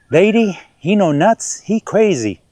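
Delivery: put-on high, slow broken-English film accent. Vlogger mimics a film line about being crazy, put-on accent. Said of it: "Sorry, I had to do the accent".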